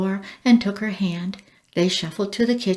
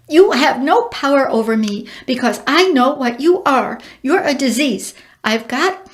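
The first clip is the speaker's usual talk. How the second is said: agitated; 4 semitones higher, 5 dB louder than usual. A narrator's reading. Frantic accusation of the bird.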